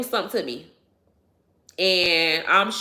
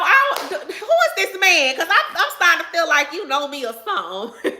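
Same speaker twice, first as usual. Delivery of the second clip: giggling through the words; amused laugh while talking; 7 semitones higher than usual. Real, not acted. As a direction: laugh it out, amused and caught off guard. Laughing at a viewer's comment.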